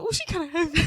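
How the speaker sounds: put-on a small child wobbling under a heavy baby; lightly voiced struggling kid; kid straining. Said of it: "I was like".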